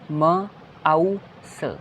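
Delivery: म आउ स split into slow separate sounds; sounding out slowly. Shown as a demonstration. Teacher sounding out mouse for the class. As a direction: stretch each sound of the word apart. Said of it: "माउस".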